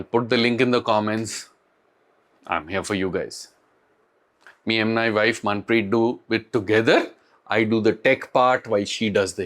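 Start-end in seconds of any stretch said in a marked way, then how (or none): 2.5-3.5 soft sincere offer. Real, not acted. Offering support to viewers.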